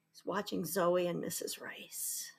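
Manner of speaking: whispered storyteller's aside; hushed aside. A narrator's reading. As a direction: whisper it confidentially to the listeners. Hushed aside to listeners about Jack.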